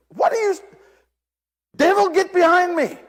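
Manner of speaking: high, loud alarmed rebuke; rebuking the devil. Retold or imitated. Preacher shouts at what he thinks is the devil, alarmed. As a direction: cast it away in alarm.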